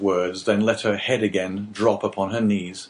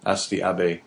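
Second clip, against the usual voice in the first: usual speaking voice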